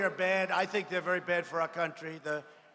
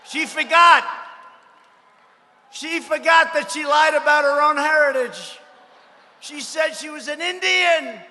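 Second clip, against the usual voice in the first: rally shout; 6 semitones higher, 9 dB louder than usual. Real, not acted.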